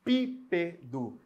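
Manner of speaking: "Pípedo" broken slowly into syllables, PI first and strongest; stressed syllable demo. Shown as a demonstration. Teacher showing the tonic syllable to students, slow "Pípedo". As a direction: spell out "Pípedo" syllable by syllable, leaning on PI. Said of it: "O pi é a sílaba tônica".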